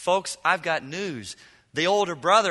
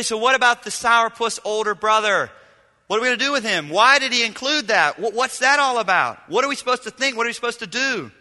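higher, louder puzzled questioning; voicing a skeptic; 5 semitones higher, 5 dB louder than usual. Imagined listener questioning the preacher, mock puzzled. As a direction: play a confused churchgoer objecting. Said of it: "You say".

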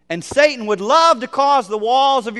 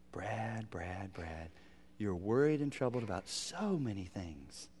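whispered name, then soft and low; whispered gentle rebuke; 10 semitones lower, 17 dB quieter than usual. Pretend. Jesus speaking to Brad in a tender whisper. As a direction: hush it like a tender correction.

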